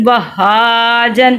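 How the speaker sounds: وہاجن with a long "haa", short "jan"; lengthened middle vowel. Shown as a demonstration. Teacher modelling the lengthening rule, drawn-out middle vowel. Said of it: "اس کو ہم لمبا کرتے ہیں".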